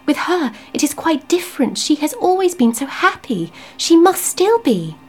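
high, urgent, tearful begging; wife begging her husband in distress; desperate pleading. Acted. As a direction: plead with him to stop.

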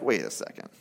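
low, quiet skeptical aside; imagined skeptic, hushed and low. Retold or imitated. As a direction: say it under your breath, pitched well down. Imagined skeptic stops to think.

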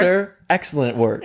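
sarcastic politeness on the edge of laughter; passive aggressive. Retold or imitated. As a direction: praise the referee with a dig. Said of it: "a little passive aggressive".

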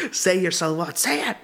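high-pitched mock-demanding mother; hammed-up pleading command; insistent mom. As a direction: imitate a mom insisting on a prayer, pitch jumping up. Mother demands a prayer be recited.

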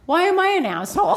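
high-pitched comic retelling; imitating a husband, pitched well up; mock misheard question. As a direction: deliver the punchline question high, holding back laughter.

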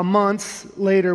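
ordinary talk

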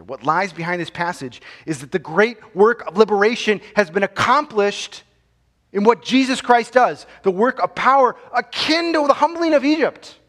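fiery sermon climax, building; preaching at full force. Acted. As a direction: hammer the victory home from the pulpit.

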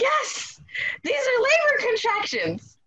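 high-pitched squeal of delight, retold; excited. Expectant mother realizing these are labor contractions, excited. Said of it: "now I'm excited".

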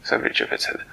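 plain reading voice

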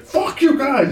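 very high mock-crying curse; squeaky, wounded wail, hammed up; imitating tearful outrage. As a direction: lash out through pretend sobs. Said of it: "he was crying like someone had shot".

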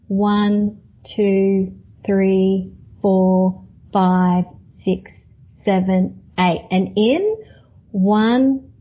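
calm, steady counting of breaths; slow breath counting. Shown as a demonstration. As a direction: guide the breathing, count evenly. Leads a breathing exercise.

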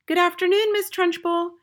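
high, singsong chirp; chirping schoolchildren. A narrator's reading. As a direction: chirp it like a class of children. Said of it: "they chirped".

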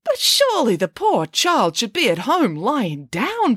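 high, swooping protest; indignant plea on behalf of someone else. A narrator's reading. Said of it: "protested Nanny Piggins".

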